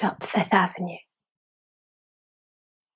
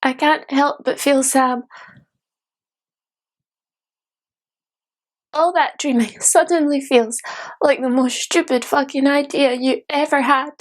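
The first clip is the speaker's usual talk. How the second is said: higher-pitched, mounting bitterness; bitter disillusion; 4 semitones higher than usual. Acted. Dreamer sees failed waitresses and sours, bitterly disillusioned. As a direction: let the disillusion push the voice up.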